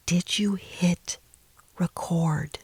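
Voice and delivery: slow whispered question from the back of the mind; inner whisper. Retold or imitated. Inner voice whispering to herself about the camera. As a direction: whisper it like a small nagging thought.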